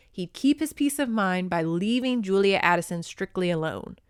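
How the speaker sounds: usual speaking voice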